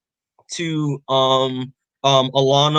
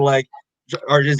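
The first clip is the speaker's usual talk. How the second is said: ordinary talk, nothing marked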